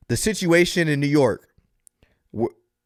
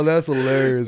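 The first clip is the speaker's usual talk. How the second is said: normal talking voice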